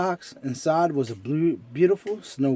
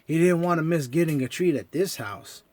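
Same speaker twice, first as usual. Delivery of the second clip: ordinary delivery, nothing marked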